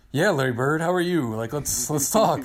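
high, loud nervous excitement; starstruck greeting. Retold or imitated. Greeting Larry Bird, starstruck and loud. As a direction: gush at a legend.

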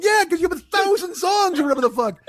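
high-pitched sarcastic comeback; mock exasperation. Reacting to the ace overpaying the jukebox, exasperated. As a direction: throw it back in a high, incredulous voice. Mocking ace's complaint about jukebox money.

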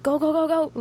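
high, mock director's urging. Crew winding up an emotional actor, high mock urging. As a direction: wind the performer up with rapid-fire cues. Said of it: "and then they're like".

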